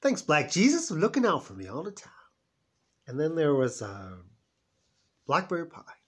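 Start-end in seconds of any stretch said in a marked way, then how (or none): 0.0-2.1 much higher, louder, thrilled; gleeful about the cake; lit-up voice over the sweets; delighted grab. Retold or imitated. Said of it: "I was like".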